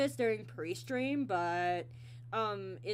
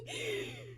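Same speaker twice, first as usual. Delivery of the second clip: real high-pitched giggle; high laugh; 6 dB quieter than usual. Real, not acted. Host laughing at own story, high giggle.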